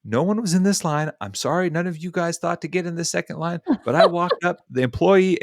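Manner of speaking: high-pitched mock scolding; retold telling-off. Woman giving it to the line-cutter, scolding. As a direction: imitate her giving it to him. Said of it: "she's giving it to him".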